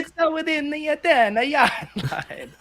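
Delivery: high, put-on Italian accent; hammed-up Italian delivery. Shown as a demonstration. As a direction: explain it in a comic Italian accent. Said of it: "explain it in the Italian accent".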